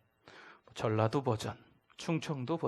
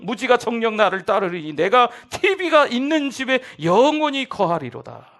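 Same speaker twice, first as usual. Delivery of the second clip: preached parody of scripture; mock psalm reading; 11 semitones higher, 15 dB louder than usual. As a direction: proclaim the parody psalm with pulpit grandeur. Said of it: "여러분, 아멘 하시면 안 돼요".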